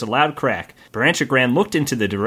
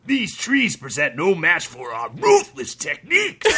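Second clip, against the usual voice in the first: boastful bandit; 4 semitones higher than usual. Acted.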